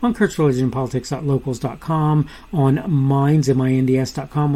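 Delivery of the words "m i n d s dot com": ordinary talk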